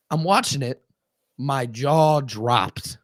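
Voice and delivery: normal talking voice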